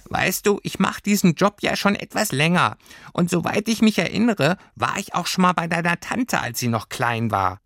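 high, tiny creature voice; a small homesickness sprite speaking up; little Heimweh voice. A narrator's reading. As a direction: homesickness creature answering Lilly from above. Heimweh explains its job.

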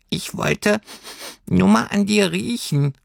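high creature voice between sniffs; little creature sniffing her; high, sniffly creature. Acted. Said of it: "sagte das Heimweh schnüffelnd".

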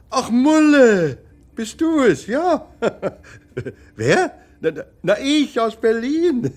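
high, loud, fond and laughing; light up at hearing her voice; overjoyed to reach his wife on the phone; delighted greeting. Acted. Husband delighted to hear his wife.

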